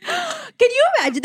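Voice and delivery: real shock leaping up high; shocked gasp. Real, not acted. Co-host reacting to the twist, gasping high in disbelief.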